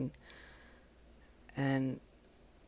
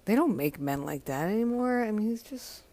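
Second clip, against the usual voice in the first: ordinary delivery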